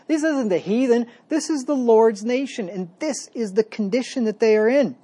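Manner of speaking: fervent building sermon climax; preaching at full force. Acted. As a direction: drive the point home with rising passion. Building rebuke of God's nation.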